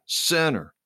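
high, accusing yell. A narrator's reading. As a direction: hurl the word at her like an accusation. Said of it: "Sally heard her yelling".

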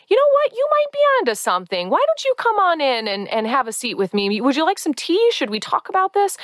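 high, sugary welcoming voice; overly polite hostess inviting a jerk in; mock sweet host.

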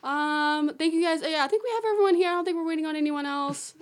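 high, upbeat guide patter; tour guide gathering crowd. Retold or imitated. Improvised guide to a tour group, put-on welcoming voice.

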